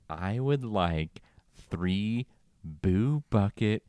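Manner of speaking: drawn-out, deliberate mock order; slow careful order. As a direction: spell out each word like to a confused cashier. Mimicking Amy's slow careful order.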